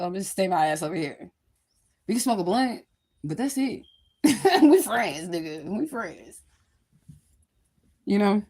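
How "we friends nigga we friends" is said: laughing. Acted. Telling a date just friends.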